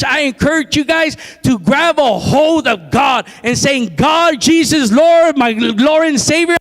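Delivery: impassioned sermon climbing in pitch to a rallying cry; high, relentless pulpit exhortation; fervent preaching. Real, not acted. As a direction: urge the congregation on, building to the climax. Preaching builds to rallying climax.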